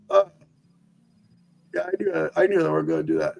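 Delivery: the bracers chime in, quick and smug; bracers speaking. Acted. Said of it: "and it just goes".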